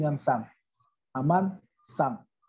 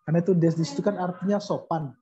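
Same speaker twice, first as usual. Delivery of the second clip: ordinary talk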